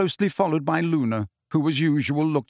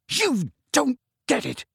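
raised, higher shout of frustration; 11 semitones higher than usual. A narrator's reading. Harry shouting at Hermione in frustration, raised voice. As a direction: yell it back at her in frustration. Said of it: "Harry shouted at her".

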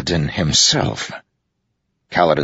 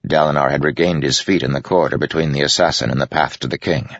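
ordinary delivery

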